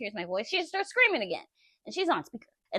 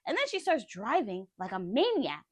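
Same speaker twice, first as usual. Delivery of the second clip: usual speaking voice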